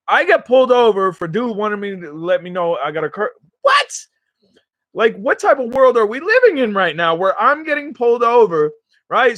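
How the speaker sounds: high-pitched, indignant disbelief; incredulous rant. Real, not acted. Driver venting to viewers about a curfew stop, incredulous. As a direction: raise the pitch in outraged disbelief.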